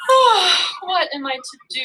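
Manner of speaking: a high, loud "Oh" breaking into a plea; a goddess at her wits' end, crying out; despairing cry. A narrator's reading. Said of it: "the goddess cried".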